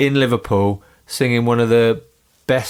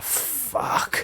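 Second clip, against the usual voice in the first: mock whisper; 11 dB quieter than usual. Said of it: "must have been like".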